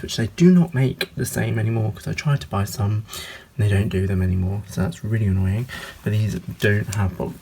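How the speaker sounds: ordinary talk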